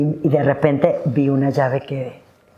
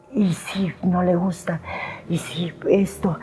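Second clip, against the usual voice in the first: susurro lento y asustado; miedo; 5 dB quieter, slower than usual. Retold or imitated. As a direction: se susurra sus dudas con pavor. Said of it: "como pavor". (susurro temeroso)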